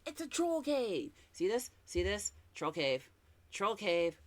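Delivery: high, excited repeated cries of troll cave; excited shouting. Retold or imitated. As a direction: point and squeal with excitement at every hole in the rocks. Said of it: "I was like".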